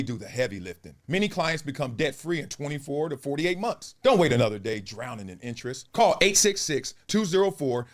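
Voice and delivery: smooth announcer ad read. Acted. Announcer pitching debt relief to listeners, smooth. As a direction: read it like a radio spot.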